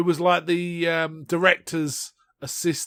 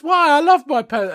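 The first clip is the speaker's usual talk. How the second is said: offended king; 12 semitones higher, 9 dB louder than usual. Retold or imitated. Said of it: "And he goes".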